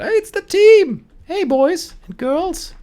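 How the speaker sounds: very high, loud, drawn-out hail; excited greeting call. Real, not acted. Streamer greeting the team on rejoining them, excited high call. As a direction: call out in a delighted falsetto. Calling out to teammates on arrival.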